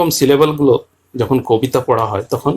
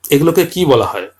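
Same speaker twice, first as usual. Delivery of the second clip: normal talking voice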